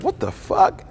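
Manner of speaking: high squeaky disbelief, voice jumping way up; shocked. Retold or imitated. Guy reading a girl's Tinder message, shocked high squeak. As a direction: yelp it like you can't believe the text.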